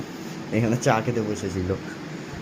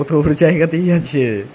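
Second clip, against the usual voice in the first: raised, insisting on জায়গাতেই; louder insistence; 5 semitones higher, 5 dB louder than usual. Real, not acted. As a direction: stress জায়গাতেই, said louder and firmer. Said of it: "repeats same line louder".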